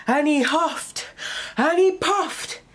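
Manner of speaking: ordinary delivery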